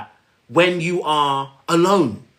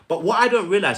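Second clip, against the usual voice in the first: ordinary talk, nothing marked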